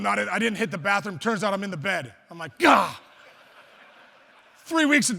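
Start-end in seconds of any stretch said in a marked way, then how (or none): 2.6-3.0 loud startled cry; horrified yelp. Retold or imitated. Kid realizes he's wetting the bed, loud yelp. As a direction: jolt awake with a yell.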